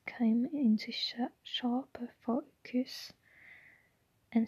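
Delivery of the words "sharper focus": plain reading voice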